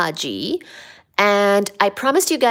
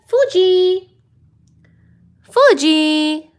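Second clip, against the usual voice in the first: high loud call; loud high call for Fuji; calling the cat; 9 semitones higher, 6 dB louder, much slower than usual. Real, not acted.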